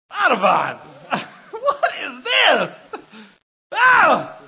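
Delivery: high, drawn-out yell of comic horror; horrified shouting. Retold or imitated. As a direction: cry out in hammed-up disgust and alarm. Acts out finding eyeball in soup.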